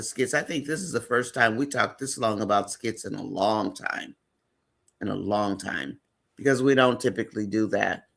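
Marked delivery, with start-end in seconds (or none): none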